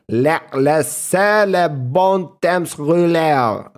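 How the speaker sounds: slow, proud mock French; French accent. Streamer showing off to chat, slow French accent. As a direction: savour every French word. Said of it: "Bro, I sound French".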